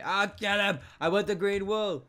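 loud, high urgent call; sudden excited yell. Real, not acted. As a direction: break off and holler after a target. Breaks off sign-off to chase.

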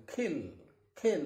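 kill as खिल, puff of air on the K; carefully aspirated खिल. Shown as a demonstration. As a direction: repeat खिल separately, breath after the K. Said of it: "तो आप ख बोलेंगे".

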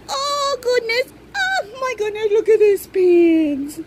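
choked-up delight; teary delight. Real, not acted. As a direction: voice breaking with happy emotion. Overwhelmed by the doll's outfit.

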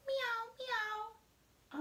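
high mewing meow; high playful meows; cat meow. A narrator's reading. Cat sound in story.

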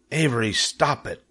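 lightly voiced scolding mother; a restrained cry of reproof; mother's cry. A narrator's reading. Mother scolds clowning Avery, mildly cried. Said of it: "cried his mother".

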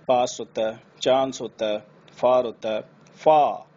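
फार said as फा with no r; British accent. Shown as a demonstration. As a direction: drop the final r, British style. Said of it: "British में हम लोग". (British उच्चारण)

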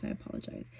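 breathy apologetic whisper; whispered aside. Real, not acted. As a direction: murmur it under your breath. Sheepish aside after self-critique.

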